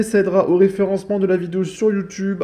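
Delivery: upbeat, high, loud sign-off; YouTube outro. Real, not acted. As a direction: pump up the outro for viewers. Outro de vidéo. (ton d'animateur enjoué)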